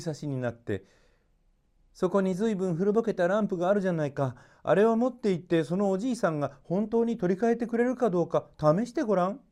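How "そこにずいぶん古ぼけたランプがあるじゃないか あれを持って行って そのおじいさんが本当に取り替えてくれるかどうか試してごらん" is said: higher, quick and lively princess; a playful young princess giving an order; bright lilting princess tone, a touch faster. A narrator's reading. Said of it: "とおっしゃいました".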